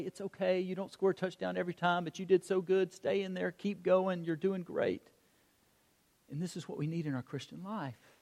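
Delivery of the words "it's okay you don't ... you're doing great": soft, comforting coach; acted consoling pep talk, hushed; gentle encouragement. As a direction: reassure a discouraged kid quietly. Coach hugging and comforting kid.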